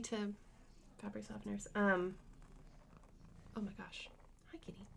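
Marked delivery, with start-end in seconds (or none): 3.6-4.9 hushed whisper to cat. Real, not acted. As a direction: whisper gently, unvoiced. Cat appears, hushed aside.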